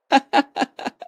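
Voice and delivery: real high laughter. Real, not acted. Laughter continues.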